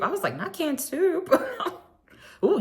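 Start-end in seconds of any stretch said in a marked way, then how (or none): 0.4-1.3 high, shocked squeal of disbelief; shrieking pity over canned soup; mock horror. Horrified reaction quote. 1.3-2.6 laughing ooh. Real, not acted. Reaction laugh.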